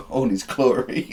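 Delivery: ordinary delivery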